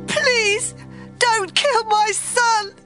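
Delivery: frantic, very high wail; screaming plea. Acted. Mother begs for her son's life, screaming. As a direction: beg in a high, drawn-out cry. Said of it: "the baby's mother screamed".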